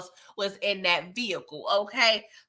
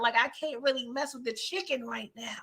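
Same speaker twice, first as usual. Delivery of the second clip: softer whispered aside; 8 dB quieter than usual. Retold or imitated. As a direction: breathe it out like a secret confession. Lucille voiced resisting temptation.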